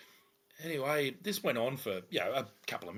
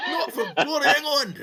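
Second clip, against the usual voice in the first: high, loud mock-outraged Scottish shout; mad Scotsman; 12 dB louder than usual. Officer re-enacting the drunk's punchline, mock Scottish bellow. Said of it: "This big mad Scotsman".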